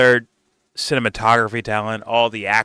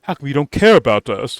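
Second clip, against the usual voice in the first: louder, higher mock complaint; mock orc complaint; 5 semitones higher, 7 dB louder than usual. Vlogger imitating orcs complaining to Sauron, mock whine. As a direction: lightly whine the grievance. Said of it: "you're like".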